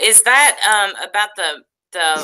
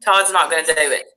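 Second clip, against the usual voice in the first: usual speaking voice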